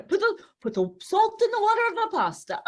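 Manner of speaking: high, loud, fast chef's decree; definite chef. Retold or imitated. Italian TV chef instructing viewers, high, loud and fast. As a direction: rattle it off like a sure Italian chef. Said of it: "You know".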